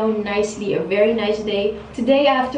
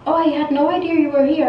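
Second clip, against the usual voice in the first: higher, faster feigned surprise; mocking the hairdresser; 5 semitones higher, faster than usual. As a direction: play the hairdresser's fake innocence, higher and faster. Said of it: "She was like".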